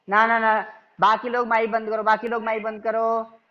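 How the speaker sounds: stern teacher order to mute mics; firm call to class. Real, not acted. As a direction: raise the voice over the class. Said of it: "teacher calls class to mute".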